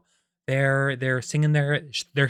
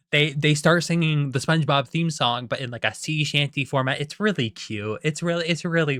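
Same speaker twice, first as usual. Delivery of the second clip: ordinary talk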